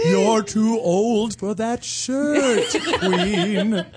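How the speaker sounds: high, slow, sassy ghost. Acted. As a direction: drawl the insults like a campy ghost. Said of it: "Can I do that ghost?".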